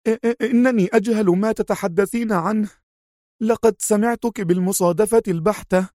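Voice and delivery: high, stuttering, pale-faced plea; stammering in fear. Acted. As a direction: stumble over every word. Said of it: "شحب وجه المربية المسكينة".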